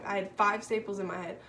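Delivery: ordinary talk, nothing marked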